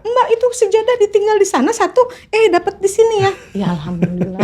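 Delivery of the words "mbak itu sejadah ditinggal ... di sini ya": very high, amused exclamation; imitated amazement. Friends react to the gifted prayer mat, imitated amazement. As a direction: squeal it as an astonished friend.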